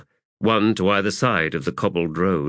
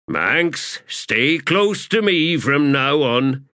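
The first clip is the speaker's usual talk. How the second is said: higher, drawn-out corporal voice; ordering corporal; 7 semitones higher, 3 dB louder, slower than usual. Acted. Corporal keeping the shaman close, ordering. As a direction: give the order slowly and firmly.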